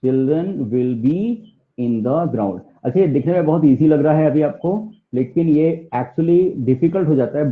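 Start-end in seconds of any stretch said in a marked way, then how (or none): 0.0-2.6 put-on very slow "children will be in the ground"; slow model sentence. Shown as a demonstration. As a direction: drag each word for learners. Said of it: "teacher models sentence".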